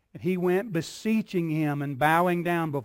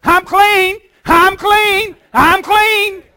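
high, loud overjoyed shouting; joyful shout; 12 semitones higher, 11 dB louder, much slower than usual. Acted. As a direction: shout it like someone just healed. Healed leper rejoicing.

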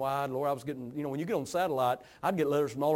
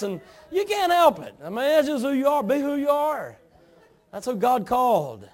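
raised pulpit voice, pitched high; fired-up preaching; 9 semitones higher, 9 dB louder than usual. Real, not acted. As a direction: preach it with rising fervor.

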